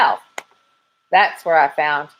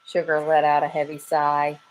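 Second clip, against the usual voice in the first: ordinary delivery, nothing marked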